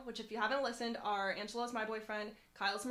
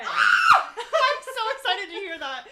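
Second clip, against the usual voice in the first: slow, loud, laughing squeal; laughing excitedly; 9 semitones higher, 11 dB louder, much slower than usual. Real, not acted. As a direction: laugh it out, thrilled. Excited about tasting new drink.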